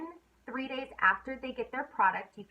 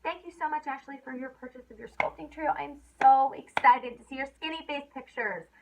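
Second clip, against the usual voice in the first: a higher, bubbly thank you that builds louder; excited thank you; 4 semitones higher, 6 dB louder than usual. Retold or imitated. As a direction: gush over the customer's purchase. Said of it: "And I'm like".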